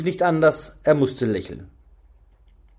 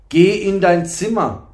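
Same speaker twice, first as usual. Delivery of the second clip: loud, higher fatherly command; stern interruption; 5 semitones higher, 7 dB louder than usual. A narrator's reading. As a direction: cut in sternly with raised voice. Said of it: "fiel der Burka ihr streng ins Wort". (streng und laut dazwischenfahrend)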